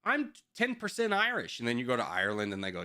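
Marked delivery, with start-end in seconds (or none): 0.1-1.6 high mocking voice of a heritage-bragging American. Mocking Americans who claim Irish roots, high mocking voice. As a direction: squeak it like a proud tourist. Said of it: "It's like".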